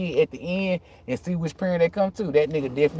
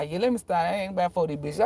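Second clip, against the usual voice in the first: ordinary talk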